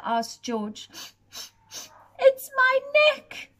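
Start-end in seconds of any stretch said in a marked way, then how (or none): 2.2-3.5 much higher sad giraffe voice. Acted. Cold giraffe telling the giant about its neck, high sad voice. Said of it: "said the giraffe".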